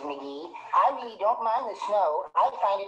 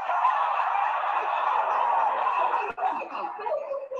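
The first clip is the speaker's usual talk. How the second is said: giggle; 3 dB louder than usual. Acted. Wimple's giggle after his joke.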